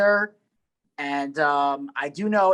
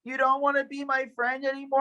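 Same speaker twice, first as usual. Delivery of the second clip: higher, lightly put-on kid voice; mock ten-year-old; 10 semitones higher than usual. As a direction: imitate a child hurt by a friend. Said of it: "This guy sounds like a ten-year-old".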